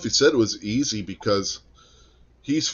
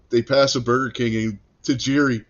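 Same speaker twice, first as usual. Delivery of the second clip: ordinary talk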